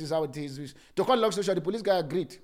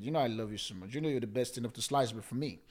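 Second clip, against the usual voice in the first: much softer and lower, tender aside; sweet talk to wife; 8 semitones lower, 8 dB quieter than usual. Acted. Pastor sweetly addressing his wife aside, soft and tender. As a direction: coo it to your wife. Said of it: "I just need to twang that part".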